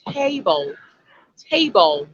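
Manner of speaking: table with a long 'ay' in the first syllable; lengthened A. Shown as a demonstration. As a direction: draw out the A in table. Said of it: "the A sound has a length to it".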